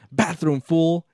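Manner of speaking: hammed-up yell of Bathroom, fool, pointing the insult; mock yell. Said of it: "he yells".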